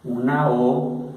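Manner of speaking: usual speaking voice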